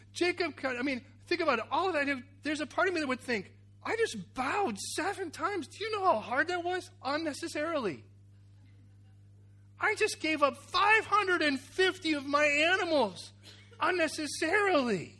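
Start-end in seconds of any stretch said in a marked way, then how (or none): 3.8-15.1 high-pitched, drawn-out complaint; mock indignation. [name] complains about seven bows and lost animals, mock indignation. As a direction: protest the wasted effort in a raised voice. Acts his own indignant thought as Jacob.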